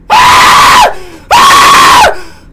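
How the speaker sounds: shrill startled shriek; high scream. Real, not acted. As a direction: scream in real fright. Startled by game event.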